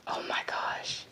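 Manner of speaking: breathless whisper; awed whisper while watching the pet. Real, not acted. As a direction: hush it so you don't scare her.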